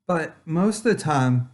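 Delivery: ordinary talk, nothing marked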